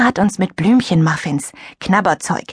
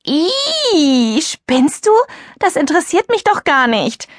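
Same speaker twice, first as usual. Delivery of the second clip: much higher disgusted squeal; outraged; 10 semitones higher, slower than usual. Acted. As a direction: shriek it in outrage. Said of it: "sprang empört auf".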